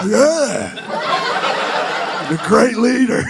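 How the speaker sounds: high, laughing fawning tone; hammed-up adoring follower; mock worship. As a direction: pretend to be a devoted fan. Mock cult praise of Trump.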